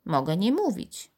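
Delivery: usual speaking voice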